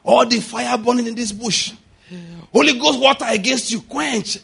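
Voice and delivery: imitating a believer praying against fire; high-pitched mock prayer command; mock fervent prayer. Mimics believers' fire-killing prayer.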